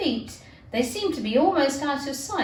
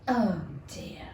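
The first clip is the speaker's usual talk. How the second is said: breathless whisper of dismay; whispered oh dear; 6 semitones lower, 5 dB quieter than usual. Acted. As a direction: whisper it to yourself. Unvoiced aside.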